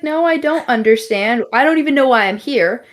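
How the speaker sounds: lightly hammed-up confusion; bewildered protest. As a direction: protest as if utterly lost. Acted bewildered reply to the voice.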